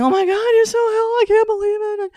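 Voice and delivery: very high, squeaky tearful wail; imitating a woman crying with gratitude; hammed-up sobbing disbelief. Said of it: "She's crying".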